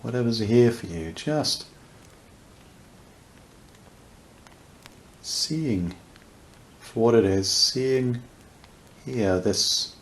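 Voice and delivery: unhurried meditation voice with long pauses; guided meditation. Real, not acted. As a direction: guide listeners gently into awareness, leaving space between phrases.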